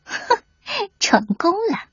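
sly weasel gloating to himself; crafty, self-satisfied villain aside; sneaky sniggering. Acted. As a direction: gloat privately over a trick that worked. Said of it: "黄鼠狼边偷笑边往回跑".

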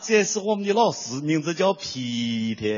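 drawn-out Sichuan accent on 皮特. Shown as a demonstration. Imitating a Sichuan classmate naming the teacher, Sichuan accent. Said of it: "四川有的地方他没有这个特的发音".

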